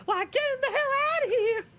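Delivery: high shrieking yell; mock yell. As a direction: shriek it out as a joke. Mock outburst, then "just kidding.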